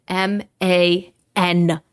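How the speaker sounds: each letter M A N set apart, slow; spelled out slowly. Real, not acted. Stressing to viewers he was a grown man, spelled slowly.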